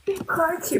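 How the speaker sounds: ordinary delivery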